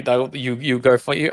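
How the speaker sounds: ordinary delivery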